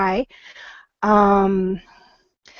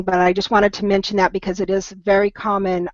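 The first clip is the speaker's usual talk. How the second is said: ordinary delivery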